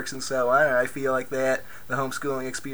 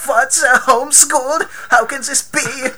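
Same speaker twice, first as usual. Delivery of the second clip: put-on high, loud German accent; 11 semitones higher, 9 dB louder than usual. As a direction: imitate the German dad's outrage. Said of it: "says it all in his German accent".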